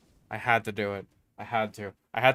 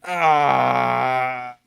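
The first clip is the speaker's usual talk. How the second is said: frustrated shout; 4 dB louder than usual. Real, not acted. Frustrated after getting killed.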